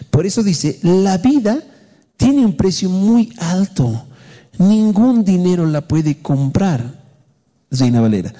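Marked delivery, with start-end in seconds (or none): 0.8-7.0 slow, raised-pitch declamation; scripture reading. Acted. Pastor reading the Psalm to the congregation, slow declamation. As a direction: read it out solemnly, drawing out each phrase. Repeats the Bible verse.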